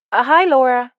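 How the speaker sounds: put-on light American accent, a touch brighter; lightly voiced American woman. A narrator's reading. Rosemary greets Laura on the video call, mild American accent. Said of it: "she said in an American accent".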